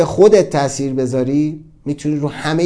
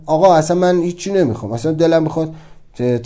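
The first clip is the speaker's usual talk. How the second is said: usual speaking voice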